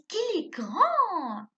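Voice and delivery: loud, high, slow exclamation. Shown as a demonstration. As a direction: marvel out loud, stretching the last word.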